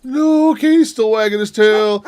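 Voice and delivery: excited relief. Real, not acted. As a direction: still jittery, point out the wagging tail. Relief, still worked up.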